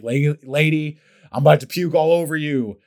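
loud, high, strained warning; desperate outburst. Retold or imitated. Murphy to Brittany, nauseous and loud. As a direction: blurt it out like you're about to heave.

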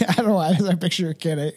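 normal talking voice

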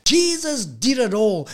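ordinary talk, nothing marked

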